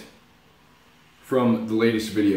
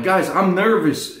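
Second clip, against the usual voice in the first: higher, slower confession; admitting nerves; 6 semitones higher than usual. Shown as a demonstration. Vlogger telling viewers he's nervous, slow higher confession. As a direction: own up to it slowly.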